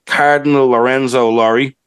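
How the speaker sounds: ordinary talk, nothing marked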